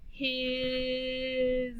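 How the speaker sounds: HIS stretched very long with a buzzing z; level, drawn-out "His" ending in zzz; sounded out. Shown as a demonstration. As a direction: blend "His" slowly, hiii-zzz. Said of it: "has a Z sound at the end".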